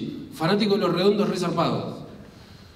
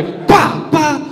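high, loud "pa" impact noises; sharp mock thumps; imitated blows; 12 semitones higher, 9 dB louder than usual. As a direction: punch out comic hitting sounds. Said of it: "así, pegándome".

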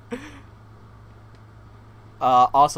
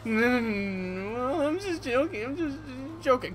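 mocking imitation; 9 semitones higher than usual. Mocking his "just joking.

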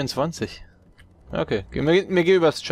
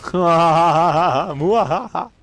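evil chuckle; 4 semitones higher, 7 dB louder, much slower than usual. Real, not acted. Gloating after a kill in game.

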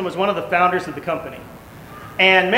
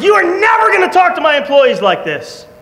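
loud, higher telling-off; angry shout; 9 semitones higher, 4 dB louder than usual. Retold or imitated. Preacher retells telling off the abusive founder, shouting. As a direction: order him out, furious. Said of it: "And I said".

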